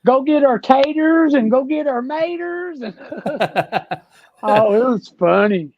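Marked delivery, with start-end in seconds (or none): 0.0-2.8 thick Southern accent. Shown as a demonstration. Tennessee man hams up his country accent for Canadians in a bar, loud and high. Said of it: "I could really get down in the South".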